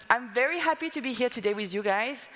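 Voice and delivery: usual speaking voice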